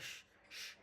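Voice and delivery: voiceless hushed sh; whispered শ sound; isolated sh sound. Shown as a demonstration. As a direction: hiss a palatal শ- on its own. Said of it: "তালবস্যর মতো আওয়াজ হয়".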